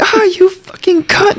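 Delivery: high, strained, drawn-out insult; mock angry curse. Retelling the villain's fight with Wonder Woman, mock outrage.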